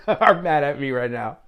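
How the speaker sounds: real laughing tease at parents. Real, not acted.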